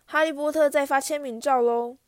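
loud sneering call with the stress on 特; mocking shout. Bully mocking Harry before the crowd, loud sneering shout. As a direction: yell 哈利波特 as a jeer to everyone. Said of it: "马粪对着人群吼道".

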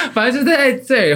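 laughing. Real, not acted. Laughs off friend's detail question.

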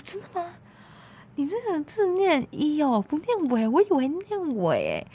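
high, caught-off-guard teacher; a hint of an embarrassed, surprised professor; embarrassed surprise. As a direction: play the awkward teacher who just found out. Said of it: "老师就非常尴尬".

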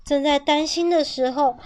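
usual speaking voice

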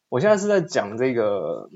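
ordinary talk

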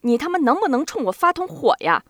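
loud, goading outburst; angry shouting. A narrator's reading. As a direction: yell it at him, loud and high. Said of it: "佩文冲他大嚷大叫".

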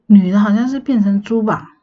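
ordinary talk, nothing marked

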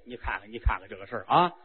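low, quick chuckling voice; a greedy king delighting in easy money; the king gleefully tells his queen another chance to get rich has come. Acted. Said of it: "宣王乐了".

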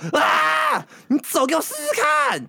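a sudden high yell, then a threat; imitated roar. Boyfriend roaring at her not to leave, as a high, imitated yell. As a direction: bellow the threat from the other room. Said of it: "他就突然从里面大吼".

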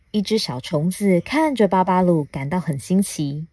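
plain reading voice

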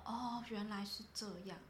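soft, drawn-out "oh, I see"; dawning realization. Retold or imitated. Boss mimics employee finally understanding, soft slow realization. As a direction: quietly act out someone slowly getting it.

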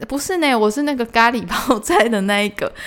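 loud patient repeat of the order; exasperated correction. Retold or imitated. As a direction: correct her again, slightly fed up.

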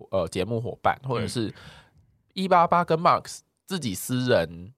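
ordinary delivery

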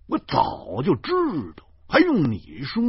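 high, emphatic, indignant retort; loud sharp shout. A narrator's reading. Driver cuts off the directions, shouting defiantly. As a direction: shout it back, leaning on key words. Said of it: "高声断喝".

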